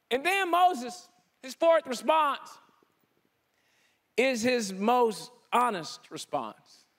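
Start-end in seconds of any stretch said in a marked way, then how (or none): none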